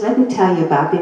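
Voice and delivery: rattled off fast and offhand; quick aside. Retold or imitated. Patient retelling how the doctor talked only about himself, fast offhand. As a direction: toss the line away quickly. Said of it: "He never heard, never asked me a question".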